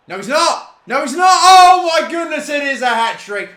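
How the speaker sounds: high, loud disbelieving shout; excited yelling. Real, not acted. Gamer celebrating a hat trick goal, ecstatic shouting. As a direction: erupt as the goal stands.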